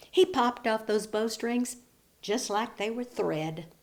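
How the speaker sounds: ordinary delivery, nothing marked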